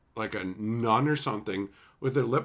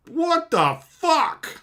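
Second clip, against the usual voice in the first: slow, drawn-out, much higher outraged "What the fuck"; stunned disbelief; 7 dB louder, much slower than usual. Retold or imitated. A stranger in the theater reacting to the abrupt ending, stunned. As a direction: let the disbelief stretch out.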